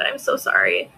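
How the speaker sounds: a breathy whispered sorry; whispered apology. Real, not acted. Apologizing to viewer Amanda.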